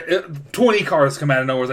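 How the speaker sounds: ordinary talk, nothing marked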